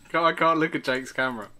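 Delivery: normal talking voice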